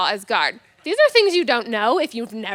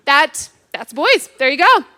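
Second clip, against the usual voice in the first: normal talking voice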